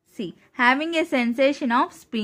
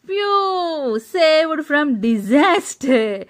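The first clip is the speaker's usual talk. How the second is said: relieved phew; 4 semitones higher, 5 dB louder, much slower than usual. Acted. Relief after landing safely.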